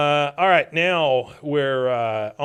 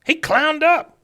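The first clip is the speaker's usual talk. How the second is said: much higher, louder put-on enraged old veteran; angry old man voice; 7 semitones higher, 5 dB louder than usual. Cotton spotting his stolen Cadillac, high, loud and furious. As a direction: spit it out like a cranky old soldier seeing red.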